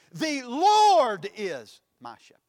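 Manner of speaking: "Lord" drawn out high and loud; stress on LORD. Shown as a demonstration. Preacher shows how emphasis changes meaning, stressing "Lord". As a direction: hit "Lord" long and hard, then drop away. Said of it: "you can place emphasis on it".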